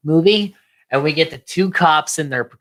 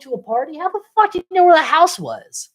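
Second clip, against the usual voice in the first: high-pitched disbelief; incredulous; 12 semitones higher than usual. Real, not acted. Podcaster questioning Billy's knowledge, high incredulous voice. Real incredulity at plot hole.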